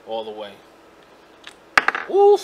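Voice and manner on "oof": high loud oof of heat; loud yelp. Real, not acted. Burning hot hit.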